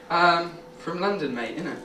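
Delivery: London slang voice. Retold or imitated.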